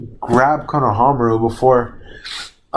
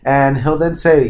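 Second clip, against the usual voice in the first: ordinary talk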